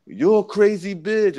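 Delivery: high, slow Spanish accent imitation. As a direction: put the Spanish dude's accent on it again. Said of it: "with an accent".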